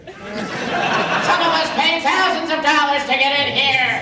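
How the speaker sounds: mock a fan who paid thousands; posh mock VIP voice; snooty rich fan. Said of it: "You don't talk like that".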